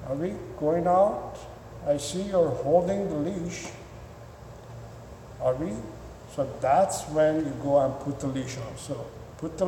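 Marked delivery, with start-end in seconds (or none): 0.1-5.7 lightly voiced subdued dog; a hint of a pet resigned to waiting; calmed-down dog. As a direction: ask softly and hesitantly, with pauses. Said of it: "After twenty minutes".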